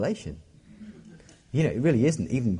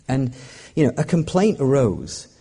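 ordinary talk

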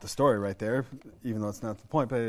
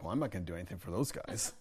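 muttered under the breath with a laugh; mumbled aside; 5 semitones lower, 8 dB quieter, faster than usual. Retold or imitated. Visa officer mumbling to his secretary, quiet muttered aside. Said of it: "he mumbles like".

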